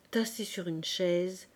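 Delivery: plain reading voice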